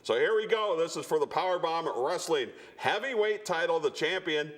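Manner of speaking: high, hyped main-event call; hyped announcer build-up. Acted. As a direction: sell the title match big. High-energy intro of the main event.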